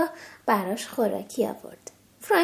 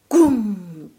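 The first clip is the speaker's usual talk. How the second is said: low loud booming imitation; thunder boom; 5 semitones lower, 6 dB louder, much slower than usual.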